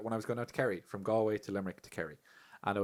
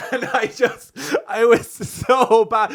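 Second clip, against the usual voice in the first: usual speaking voice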